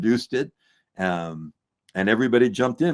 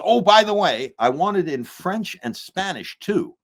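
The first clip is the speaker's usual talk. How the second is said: high, loud afterthought; pitched-up impression of a director on the phone; David Lynch; 8 semitones higher, 3 dB louder than usual. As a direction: call it out high, like a director adding one more demand. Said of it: "And he says".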